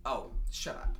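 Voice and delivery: ordinary talk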